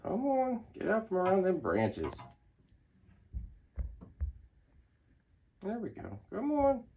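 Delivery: high, soft coaxing; coaxing pet voice. Real, not acted. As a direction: sweet-talk a shy animal out. Coaxing a snake out of cage.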